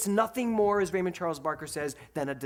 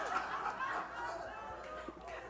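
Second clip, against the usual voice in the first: high breathy laugh; 9 dB quieter than usual. Real, not acted. Laughs mid story.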